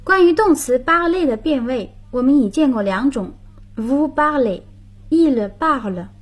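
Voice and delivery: ordinary talk, nothing marked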